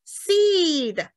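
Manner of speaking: seed with the ee stretched long; seed held on a long ee; long vowel. Shown as a demonstration. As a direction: draw out the ee in seed. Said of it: "你就知道它是长音".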